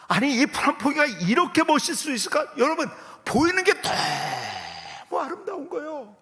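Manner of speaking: loud, high-pitched preaching amazement; exclaiming in wonder. Retold or imitated. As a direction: cry out, marvelling at a world made new. Relives awe at transformed world.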